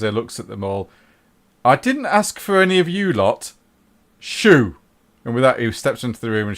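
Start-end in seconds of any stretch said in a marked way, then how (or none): 1.6-4.8 loud, high scolding; exasperated, raised-voice brush-off; irritated dismissal. Acted. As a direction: send the onlookers packing. Reese dismisses the onlooking cabal.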